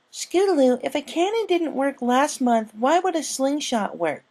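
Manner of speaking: a small filly chiding her friend; young filly rebuking. A narrator's reading. Sweetie Belle scolds Scootaloo, high filly voice. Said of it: "Sweetie Belle rebuked".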